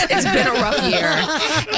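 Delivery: slow, hammed-up grave voice, laughing through it; exaggerated imitation of a boss's serious tone; mock serious tone. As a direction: drag it out like a solemn announcement, half laughing. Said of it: "but it was like".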